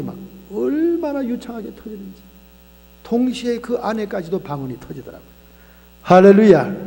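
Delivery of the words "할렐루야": loud, falling "할렐루야"; preacher shouting praise to the congregation; shouted hallelujah. Real, not acted. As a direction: proclaim it to the congregation.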